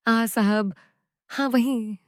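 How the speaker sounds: breathy moaned words, drawn out on the आ; soft moaning. A narrator's reading. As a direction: sigh the words out in pleasure. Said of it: "मैं कराह रही थी".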